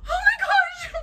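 sudden high shriek; real tearful yelp of fright; panicked scream. Real, not acted. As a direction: scream it, startled. Spider runs quickly.

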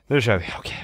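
normal talking voice

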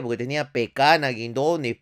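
ordinary talk, nothing marked

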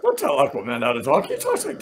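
put-on hero voice cracking like a teenager's; indignant mock superhero, voice breaking upward; Aquaman. Superhero telling off his co-host, cracking Aquaman voice. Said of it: "teenager with a voice starting to break".

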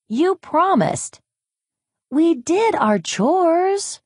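high, slow little chick voices; chicks whining. Acted. Hungry chicks complain to Hen, high and whiny. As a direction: pipe up like small hungry chicks.

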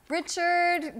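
a high, loud call of the name; yelled name. Retold or imitated. Barista calling the next order, a loud, high yell. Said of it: "yells out this name".

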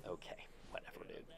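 whispered whatever. Real, not acted. Gamer mutters at his cowardly baserunner, whispered. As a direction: mutter it under your breath, dismissive. Brushing off runner not advancing.

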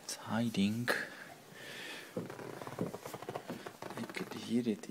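soft stalking whisper. Real, not acted. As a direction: keep it hushed, stalking. Whispering while stalking the fly.

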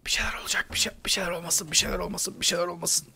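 hushed, tense, high whisper; frightened pleading whisper; scared whisper. Real, not acted. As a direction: breathe it out, dreading a jump scare. Nervous player fearing a scare.

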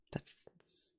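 hushed, disgusted whisper. Real, not acted. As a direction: breathe it out in disgust. Disgust at a cheating boyfriend.

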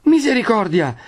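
high-pitched startled exclamation; Agnese's alarmed cry. A narrator's reading. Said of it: "grida anche Agnese". (grido d'allarme acuto)

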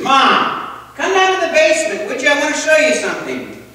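put-on high, eager child calling up from the basement; little boy's voice. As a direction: sound like a small kid begging mom to come. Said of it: "this little voice called out".

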